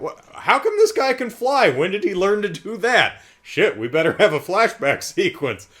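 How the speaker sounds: high, loud mock-confused studio voice; hammed-up befuddled moviegoer; baffled test audience. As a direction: play a panicky studio exec who just noticed he can fly. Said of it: "did you have test audiences going".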